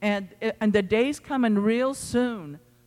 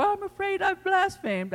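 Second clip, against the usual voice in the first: a hint of a fearful believer, much higher; mock worried; 11 semitones higher than usual. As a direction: voice the worried churchgoer lightly, pitched up high. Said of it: "they've come to me in the altar before".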